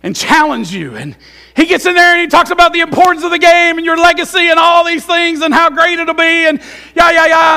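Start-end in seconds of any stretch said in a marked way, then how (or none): 1.6-7.6 loud, high, fast pep-talk shout; hammed-up locker-room rally yell; pumped-up coach. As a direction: fire the team up like a coach before the game.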